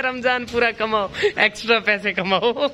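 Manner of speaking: high, a burst of laughter carrying into the line; laughing joke. Real, not acted. Vlogger joking with shopkeeper friend, laughing. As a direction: laugh then joke with a grin. Joking about Ramadan earnings.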